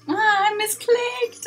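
ordinary talk, nothing marked

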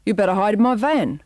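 lightly voiced terrier; a hint of a dog character, timbre shifted; barked reply. A narrator's reading. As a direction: bark back matey advice. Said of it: "barked back the Staffordshire".

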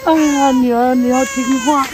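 put-on loud, crying plea to a baby; acted tearful parting; tearful farewell. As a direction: say goodbye through tears. Mother parting from abandoned baby.

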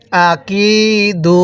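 slow "আকিদু" with a long held কি; drawn-out vowel. Shown as a demonstration. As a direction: stretch the কি in "আকিদু". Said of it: "এরকমভাবে পড়ব".

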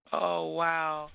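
normal talking voice

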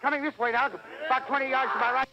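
a live reporter losing control as the flames spread toward him; terror. Acted. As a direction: pitch shooting way up in mounting panic. Reporter sees men burned alive.

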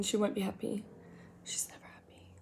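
unvoiced teasing whisper. Real, not acted. Whispering a teasing aside about the eyebrow commenter.

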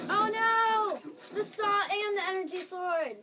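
high, loud cry of alarm; dramatic dismay. Pretend. Player facing feared weapons, dramatic loud dismay. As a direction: wail it out in playful horror.

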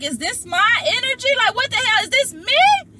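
very high, loud incredulous questioning; retold high-pitched outburst of disbelief; mock disbelief. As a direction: wail the questions in exaggerated bewilderment. Acts out disbelief at own crying.

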